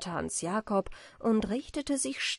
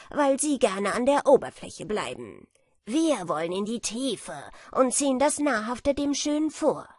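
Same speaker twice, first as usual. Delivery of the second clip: louder, self-important beetle voice; a smug know-it-all beetle teasing; lightly voiced boastful beetle; proud beetle; 3 semitones higher, 6 dB louder than usual. A narrator's reading. Beetle boasts about food.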